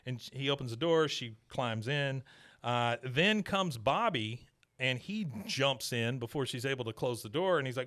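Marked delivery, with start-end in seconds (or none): none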